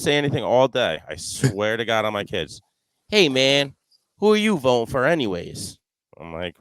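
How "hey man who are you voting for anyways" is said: higher put-on buddy voice, out of the blue; mock the friend's casual question; imitating Mike. Golfer quoting his buddy on the course, higher put-on voice. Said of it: "he goes".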